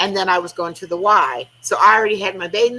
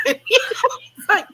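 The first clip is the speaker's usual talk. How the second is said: laughing; 5 semitones higher than usual. Real, not acted.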